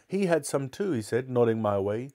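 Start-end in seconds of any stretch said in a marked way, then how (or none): 0.1-0.9 higher-pitched tattling retort; defensive, pointed accusation; accusing cook. Acted. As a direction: shift the blame onto someone else.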